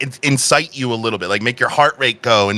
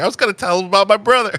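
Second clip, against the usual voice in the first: rushed mocking imitation; 8 semitones higher, much faster than usual. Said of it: "you hear him literally just saying".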